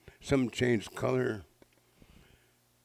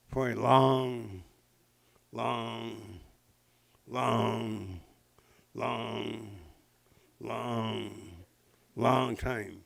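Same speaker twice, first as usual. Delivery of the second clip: each long slow and fading softer; drawn-out long; 5 dB quieter, much slower than usual. A narrator's reading. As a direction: stretch every long, drifting away. Stretching a long dive.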